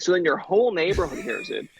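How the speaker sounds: normal talking voice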